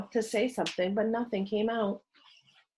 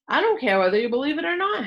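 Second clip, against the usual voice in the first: fast, louder, higher brush-off; defiant retort; 7 semitones higher, 5 dB louder, faster than usual. A narrator's reading. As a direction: fire it back without flinching. Henry bluffing defiantly.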